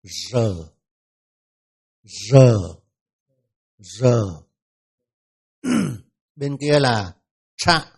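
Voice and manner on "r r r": R said three times as a clear isolated sound; letter R drill. Shown as a demonstration. Teacher isolates the R consonant for students. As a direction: hold R alone, no vowel after it. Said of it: "Đó là âm".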